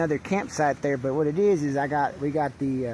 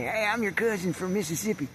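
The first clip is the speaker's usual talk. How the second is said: high, put-on cousin greeting; high hammed-up hello; calling to strangers; 7 semitones higher than usual. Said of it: "go over there and say".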